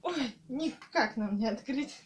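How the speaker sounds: ordinary talk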